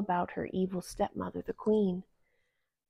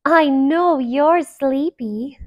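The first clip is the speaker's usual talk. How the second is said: sweet princess voice; 6 semitones higher, 10 dB louder, slower than usual. Acted.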